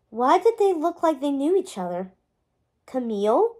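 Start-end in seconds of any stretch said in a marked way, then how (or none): 2.9-3.6 rising, questioning tone on "Camille". A narrator's reading. Merlin thinks he recognises her, questioning with a rise on "Camille". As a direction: say "Camille" as an uncertain question, pitch lifting. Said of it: "Merlin questioned".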